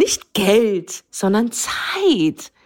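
"Zeit" stretched out very slowly; slow, weighty contrast landing on "Zeit"; stress on Zeit. Real, not acted. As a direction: lean on "sondern Zeit", dragging it out. Contrast emphasis.

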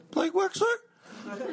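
put-on slow, very high falsetto girl; girl caller. Girl caller asking for Blake, slow high falsetto. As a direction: draw it out in a mock girlish squeak. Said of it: "She goes".

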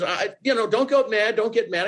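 high, hurried-to-soothe retold voice; placating boss. Boss telling the job seeker not to get mad, placating. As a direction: imitate a boss trying to calm someone.

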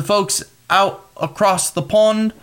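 a clumsy mock British accent; hammed-up British accent, slow; bad English accent. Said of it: "that was a really bad English accent".

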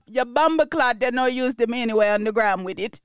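put-on shaky, half-managed Jamaican accent; fumbling through a Jamaican accent paraphrase; a hint of a Jamaican accent, struggling. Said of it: "let me do my Jamaican accent for this part".

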